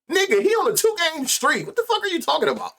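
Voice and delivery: what are you even saying, sped up and high; real scoffing disbelief at the regression talk; incredulous. Real, not acted. Scoffing at the analysts' claim.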